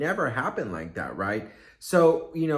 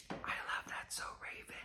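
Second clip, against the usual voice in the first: breathy, unvoiced whisper, far softer; 18 dB quieter than usual. To the celebrity at the sale rack, a secret whisper. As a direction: whisper it secretly as she passes. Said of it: "I'm just gonna whisper".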